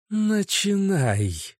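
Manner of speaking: high, slow hissing voice of darkness; creeping nightmare whisper. A narrator's reading. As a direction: hiss it like a dark spirit. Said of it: "прошипел маг голосом тьмы".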